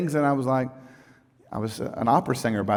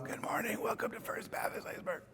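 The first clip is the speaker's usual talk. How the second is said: imitating his hoarse voice as a breathy whisper; lost-voice whisper; 8 semitones higher, 17 dB quieter than usual. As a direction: whisper the greeting as if the voice is gone. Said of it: "three or four Sundays where I'm going".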